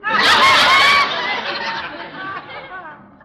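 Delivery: loud, high gloating laugh; victorious booming cackle; triumphant laugh. Acted. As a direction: crow over the joke being on them. Conklin gloats over his revenge.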